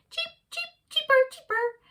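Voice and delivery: very high chirping birds; bird voices. A narrator's reading. Birds around the park chirping, very high.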